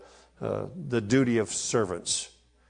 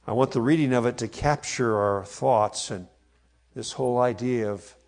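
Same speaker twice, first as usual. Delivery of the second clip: normal talking voice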